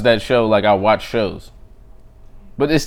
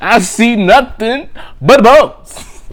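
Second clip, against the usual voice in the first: mock roast; 10 semitones higher, 7 dB louder, much slower than usual. Roast punchline, drawn out.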